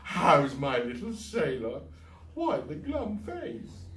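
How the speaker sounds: high, slow fussing; doting diva. Acted. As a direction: coo over the boy. Eugenie fussing over Fergus.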